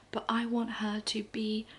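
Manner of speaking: ordinary talk, nothing marked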